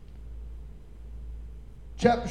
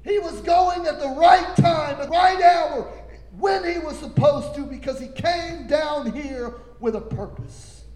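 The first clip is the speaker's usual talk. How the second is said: higher and louder, shouting the point home; full-force preaching; 5 semitones higher, 5 dB louder than usual. Acted. Preacher proclaiming the appointed hour to the congregation, shouting. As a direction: preach it hard, building to a shout.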